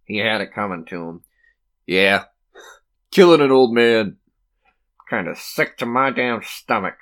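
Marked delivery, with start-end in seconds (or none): 1.9-7.0 a touch louder, sickened grumble; lightly voiced queasy onlooker; uneasy inmate. A prisoner muttering about the execution, uneasy.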